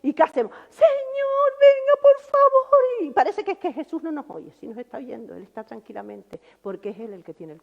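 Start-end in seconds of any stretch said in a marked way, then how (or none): 0.7-2.8 high, pleading cry to the Lord; desperate plea. Retold or imitated. Preacher mimics a frightened believer begging, high and slow. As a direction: beg like a panicked believer, drawn out.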